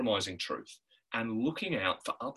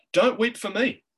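higher and louder, pressing the point; urgent Jesus plea, raised voice; emphatic plea; 8 semitones higher, 4 dB louder than usual. Acted. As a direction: repeat it as a forceful higher plea. Preacher repeats the line.

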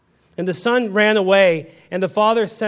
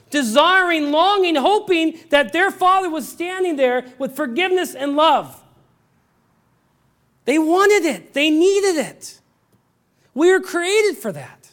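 impassioned preaching; 8 semitones higher than usual. Acted. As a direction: preach it with rising fervour. Preaching at full force.

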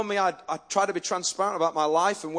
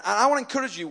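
normal talking voice

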